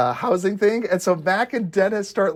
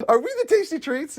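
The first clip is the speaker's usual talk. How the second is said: higher, voiced "Are we the tasty treats?" with lift on "tasty"; quoted line, acted; 11 semitones higher than usual. Quoting line from the show.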